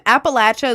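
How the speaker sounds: "Appalachia" with a short A, "latch-uh"; native pronunciation. Shown as a demonstration. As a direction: say "Appalachia" as "apple-atch-uh". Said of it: "what you call it when you're native".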